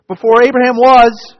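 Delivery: before Abraham was, cried out high and loud; ringing proclamation. Acted. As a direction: proclaim it with full force. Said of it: "Listen, listen, verily, verily".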